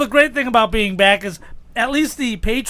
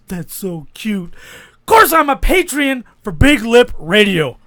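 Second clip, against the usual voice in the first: high, shouted wrestling promo; 3 semitones higher than usual. Pretend. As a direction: taunt a rival like a wrestler. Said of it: "a promo against Ric Flair".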